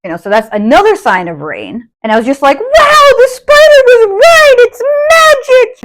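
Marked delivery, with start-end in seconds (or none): 2.6-5.8 put-on much higher, slow, wonder-struck child voice; awed little kid. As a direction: play the little girl amazed at the spider. Retelling her childhood amazement.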